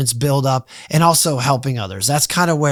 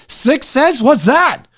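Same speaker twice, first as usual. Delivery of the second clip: amazed question; 10 semitones higher, 4 dB louder, much slower than usual. Retold or imitated. Retelling his amazement at reading.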